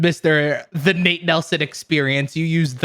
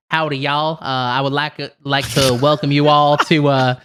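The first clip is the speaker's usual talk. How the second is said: put-on Southern drawl of a Texan host; Texan accent. Shown as a demonstration. Welcoming guests to a wedding reception, Texan accent. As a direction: lay on a Texas accent. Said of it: "talk like a Texan".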